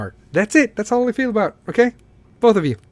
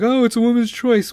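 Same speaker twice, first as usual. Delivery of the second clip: higher-pitched mimicry of an objection; mock the excuse in a higher voice; mocking quote; 7 semitones higher than usual. Podcaster mimicking people defending a choice, mocking. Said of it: "I don't want anybody to be like".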